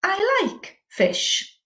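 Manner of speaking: slow, drawn-out "I like fish"; slow questioning prompt. Shown as a demonstration. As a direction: prompt the learners slowly. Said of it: "how would you say".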